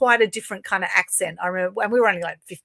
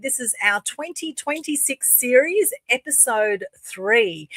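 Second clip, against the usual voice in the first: bright, drawn-out opener for the show, pitched higher; show host intro; 5 semitones higher, 5 dB louder, slower than usual. Acted. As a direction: welcome viewers like a show announcer, slowly.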